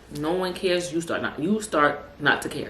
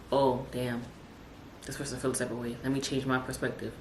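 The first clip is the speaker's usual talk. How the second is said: low, much softer musing; quiet, lowered thinking-aloud voice; imagined inner thought; 4 semitones lower, 9 dB quieter than usual. Acted. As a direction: drop down as if thinking to yourself. Imagined thought of a mind reader.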